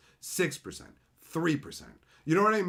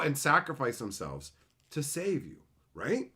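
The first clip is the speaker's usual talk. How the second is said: normal talking voice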